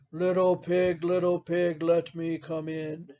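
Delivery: storybook wolf, a little low and even; story wolf. Acted. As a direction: call to the pig as a wolf at the door. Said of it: "and said".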